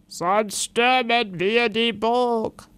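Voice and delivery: slow, measured threat; lightly voiced threatening knight. A narrator's reading. Ritter Blaubart threatens to storm the castle, slow menacing voice. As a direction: hold the threat over them. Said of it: "ruft Ritter Blaubart".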